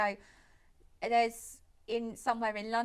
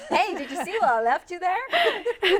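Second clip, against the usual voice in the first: high, loud mock call through a laugh; mimicked calling out; 8 semitones higher, 7 dB louder than usual. Co-host mimicking the boyfriend's call from next room, laughing. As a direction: laughingly parody someone calling out.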